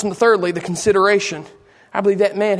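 normal talking voice

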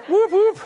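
high-pitched double whoop; celebratory whoop. Acted. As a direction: let out two quick high whoops. Preacher whoops over family high points.

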